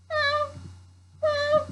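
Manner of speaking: very high, thin meows; high squeaky kitten meow; cat meow. A narrator's reading. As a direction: mew like a little cat. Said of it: "the cat meowed".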